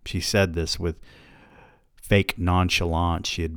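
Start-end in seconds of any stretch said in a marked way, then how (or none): none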